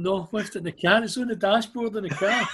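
insistent worry. Retold or imitated. Retold insistent serious reply.

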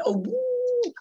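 high, drawn-out comic "woo"; mimicking a comedian's long high coo; Frankie Howerd. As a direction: swoop up into a camp comedian's ooh. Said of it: "I mean, Frankie Howerd".

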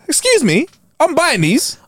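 prim shopper voice; mock posh shopper. Mock shop exchange.

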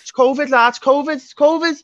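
high, loud mock Scouse accent; Scouse accent cranked way up high and loud. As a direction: ham up a Liverpool lad's Scouse accent. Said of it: "I'm not gonna do a Scouse accent".

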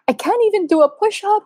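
high, whiny wail, half-played; mock frustrated whine. At the gym, wailing to her husband in a high, whiny voice. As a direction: whine it like a tearful beginner. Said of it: "crying and so mad".

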